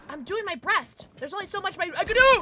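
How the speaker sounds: plead, then burst into a yell; exasperated protest. Real, not acted. Protests to nagging game announcer.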